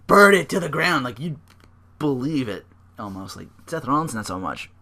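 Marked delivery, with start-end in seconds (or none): 0.1-1.0 louder intense threat, leaning on burn; lightly voiced unhinged wrestler; Dean Ambrose menace. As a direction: growl it out like a believable madman. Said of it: "if Dean Ambrose was like".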